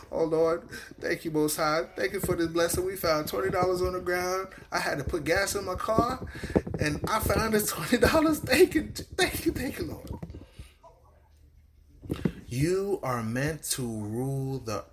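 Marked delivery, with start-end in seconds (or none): 0.1-10.1 lampoon a believer thrilled over twenty dollars; mock grateful believer. Preacher mocks a churchgoer thanking God for found cash, gushing.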